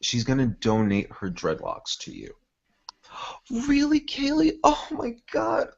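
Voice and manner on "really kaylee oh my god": put-on very high, thrilled girl voice; Sally delighted. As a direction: gasp with joy. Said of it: "And Sally's gonna say".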